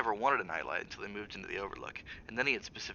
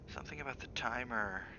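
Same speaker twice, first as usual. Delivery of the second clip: muttered; 3 semitones higher, 8 dB quieter than usual. Acted. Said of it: "Danny muttered".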